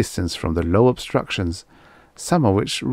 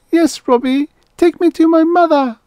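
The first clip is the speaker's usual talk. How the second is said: put-on very high, loud child voice; pleading little girl; 7 dB louder than usual. Little girl asks robot for help, pleading. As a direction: plead like a small lost child.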